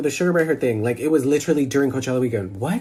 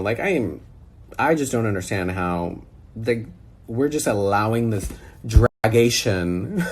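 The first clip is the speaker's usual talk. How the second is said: ordinary delivery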